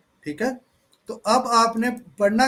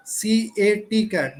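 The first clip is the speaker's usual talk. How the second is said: "सी ए टी cat" with a short pause after each letter; pausing between letters; much slower than usual. Shown as a demonstration. Teacher demoing for students a spelling with a short pause after each letter. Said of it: "ऐसे करके थोड़ा रुक कर".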